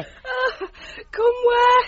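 breathless from laughter. Acted. Debbie recovering from laughing fit.

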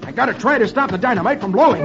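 high, fast hero voice; urgent resolve. A narrator's reading. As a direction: blurt it out racing against time. Hero racing to stop the explosion.

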